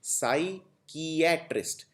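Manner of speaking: साइकियाट्रिस्ट said slowly with no P sound, sy-kee-A-trist; silent P. Shown as a demonstration. Teacher showing students साइकियाट्रिस्ट with the P dropped. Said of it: "बोलते वक्त इसका उच्चारण नहीं करना है".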